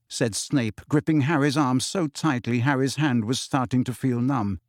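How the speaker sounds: usual speaking voice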